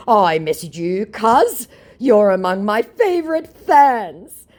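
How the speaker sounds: slow, high, loud syrupy scammer; mock scammer flattery. Mocking scammer flattering her, slow and high. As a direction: drag out the fake flattery.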